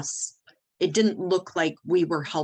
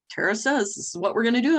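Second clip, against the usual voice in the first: retold team question, higher and rising at the end; 6 semitones higher, faster than usual. Team checking the boss's plan, questioning. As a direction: mimic the team checking, quick and high.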